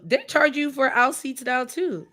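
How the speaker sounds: usual speaking voice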